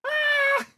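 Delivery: loud, very high shriek; high-pitched fangirl squeal; sudden piercing scream; cartoon scream.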